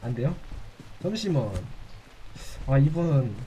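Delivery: normal talking voice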